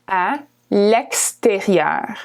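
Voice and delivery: à l'extérieur stretched out slowly; slow model. Shown as a demonstration. Teacher slowing à l'extérieur, careful slow model. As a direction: draw out à l'extérieur syllable by syllable. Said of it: "you would say à l'extérieur".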